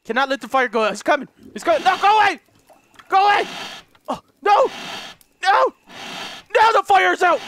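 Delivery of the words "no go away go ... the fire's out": high and loud, desperate; panicked yell. Real, not acted. Gamer as the fire goes out, panicked yell. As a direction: shout him away in a panic. Monster approaching, fire dies.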